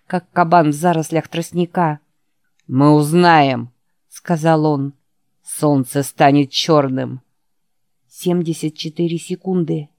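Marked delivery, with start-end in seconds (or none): 2.7-3.7 menacing villain. Acted. Villain Morgan Sloat snarls threat. 5.4-7.2 menacing villain. Acted. Villain Morgan Sloat snarls threat.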